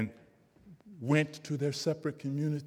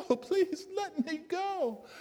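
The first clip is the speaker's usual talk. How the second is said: tiny high voice, slow and softer, falling away; the trapped creature giving up hope; high slow drooping plea; fading plea; 3 dB quieter, slower than usual. A narrator's reading. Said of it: "there's this voice saying".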